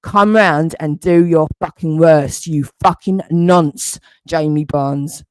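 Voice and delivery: ordinary talk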